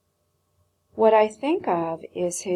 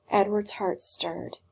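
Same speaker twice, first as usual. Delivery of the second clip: plain reading voice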